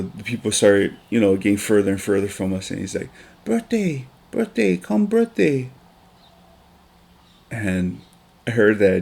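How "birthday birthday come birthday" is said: put-on much higher toddler voice, slow on the last call; little son calling. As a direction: beg them to come like a small child. Son inviting kids to birthday.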